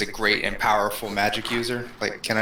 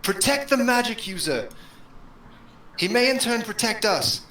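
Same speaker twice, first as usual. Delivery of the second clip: calling out; 11 semitones higher than usual. Acted. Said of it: "I cry out".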